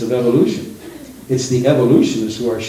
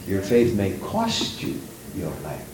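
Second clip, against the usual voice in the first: hushed warning; 5 semitones lower, 9 dB quieter, slower than usual. Acted. Solemn warning to the church.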